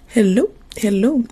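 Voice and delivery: ordinary delivery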